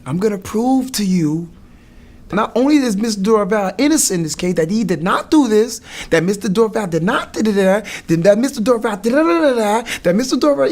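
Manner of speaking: high, loud, emphatic courtroom oratory; imitating an impassioned lawyer, rattling off points; defense lawyer's argument. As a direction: hammer each point home to a jury. Acts out lawyer addressing the jury.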